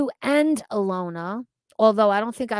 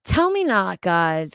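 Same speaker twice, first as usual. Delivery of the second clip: ordinary talk, nothing marked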